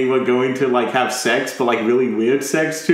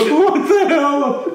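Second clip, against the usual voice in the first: high, drawn-out laughing disbelief; helpless laughing exclamation, slow and high; real laughter; 12 semitones higher, 3 dB louder, much slower than usual. Real, not acted. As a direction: crack up through the words. Cracking up at recalled joke.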